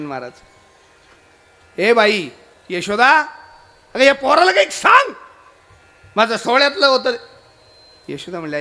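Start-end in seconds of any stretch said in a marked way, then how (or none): 1.8-7.2 calling out, rising to a high, loud protest; acted outraged holy man; indignant complaint. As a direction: shout for the mother and complain about her boy. Guru calls Yashoda to complain.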